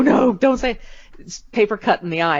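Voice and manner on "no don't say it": begging cousins. Retold or imitated. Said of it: "my cousins to go".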